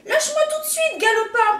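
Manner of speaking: high, loud indignant snake; indignant protest. A narrator's reading. Snake scolding the rooster, high and loud indignant. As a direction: snap it out, offended. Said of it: "proteste un serpent indigné".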